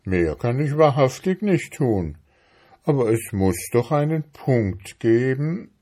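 put-on plodding beetle voice; plaintive beetle. A narrator's reading. Beetle insisting there's a point, plaintive.

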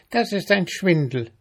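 high, indignant ant voice. A narrator's reading. Ant accusing mayfly of a swindle, indignant.

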